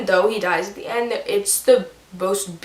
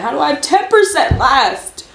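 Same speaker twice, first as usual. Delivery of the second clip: higher, louder outburst; exasperated disbelief; 7 semitones higher, 5 dB louder than usual. Real, not acted. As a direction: burst out in baffled protest. Disbelief at pages still left.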